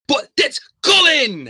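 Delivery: loud, high, drawn-out hype shout; ring-announcer style build-up; big hyped introduction. Acted. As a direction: announce the guest with a huge stretched yell. Excited announcement of guest's arrival.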